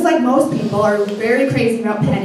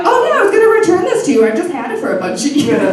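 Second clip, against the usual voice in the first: breezy excuse; 6 semitones higher, much faster than usual. Retold or imitated. Sister admitting she had the ring, fast breezy higher excuse. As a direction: rattle it off like it's no big deal. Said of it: "And she was like".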